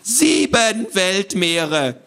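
high, very drawn-out squawky voice; squawky correction. Acted. Voice from the box corrects the boy, squawky. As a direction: stretch the correction out pedantically. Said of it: "sagte die Stimme aus der Kiste".

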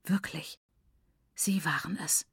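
hushed, insistent whisper; soft unvoiced insistence; whispered accusation. A narrator's reading. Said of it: "immer noch flüsternd".

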